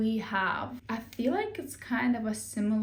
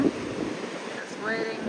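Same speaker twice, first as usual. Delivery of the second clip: drawn-out slow; much slower than usual. Shown as a demonstration.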